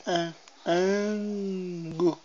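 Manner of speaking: slow teaching demo of "An-, angu"; slow syllable-by-syllable angu. Shown as a demonstration. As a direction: draw out "An-, angu" very slowly, syllable by syllable. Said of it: "Mexe a língua assim".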